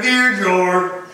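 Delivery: normal talking voice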